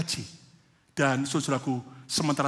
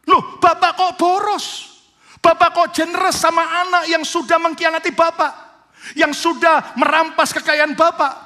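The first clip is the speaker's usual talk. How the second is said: loud, high, indignant complaint to his father; angry son; 13 semitones higher, 11 dB louder than usual. Acted. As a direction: hurl the accusation at the father, offended. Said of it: "Anak ini marah".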